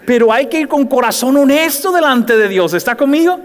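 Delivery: normal talking voice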